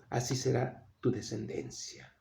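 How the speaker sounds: hushed promise. Acted. Preacher voicing God's promise to Abraham, hushed. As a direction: drop to a soft, solemn hush as God speaking.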